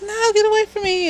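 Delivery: high, fast, frightened brush-off; panicked retort. Retold or imitated. Haley mid-flashback pushing the stranger away, high and panicked. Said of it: "And she's like".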